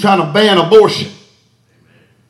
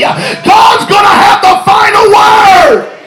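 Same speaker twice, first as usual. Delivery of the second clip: higher, louder climax shout; peak preaching cry; 11 semitones higher, 4 dB louder, slower than usual. Real, not acted. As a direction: hit the top of the build, full voice. Repeated line, peak of build.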